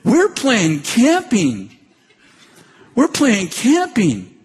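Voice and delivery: put-on high, slow sing-song child voice; children announcing their game, high and slow; kids playing. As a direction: drawl it out like happy little kids. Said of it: "They said".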